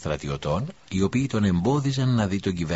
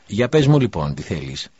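commanding question; 3 semitones higher, 6 dB louder than usual. Acted. Governor questions the prisoner.

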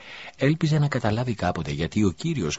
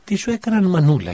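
much higher, louder crying mother; a mother sobbing over her son; weeping widow; 12 semitones higher, 9 dB louder than usual. A narrator's reading. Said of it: "κλαίγοντας το φιλούσε". (χήρα που κλαίει, πολύ ψηλά)

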